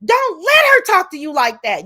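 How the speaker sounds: high, loud retold outrage; pissed-off outburst. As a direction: act out scolding a friend, exasperated. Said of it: "I was so fucking pissed".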